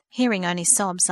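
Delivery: ordinary delivery, nothing marked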